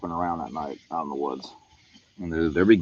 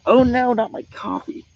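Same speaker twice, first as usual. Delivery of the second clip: squeaky, slow oh-no wail; high-pitched dismay; 7 dB louder, slower than usual. Real, not acted. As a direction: whine it high and drawn out. Coffee cup nearly spilled.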